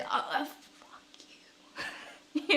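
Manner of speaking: hushed, drawn-out whispered swearing; barely voiced profanity; whispered curse. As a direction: whisper the curse slowly, under your breath. Acting out muttered curse at him.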